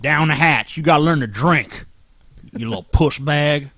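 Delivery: a hint of a rowdy dad pushing a fifth on his boy. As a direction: egg the kid on, fading. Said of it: "made you down it".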